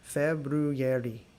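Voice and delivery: very slow February, every syllable; slowly. Shown as a demonstration. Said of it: "So go slowly".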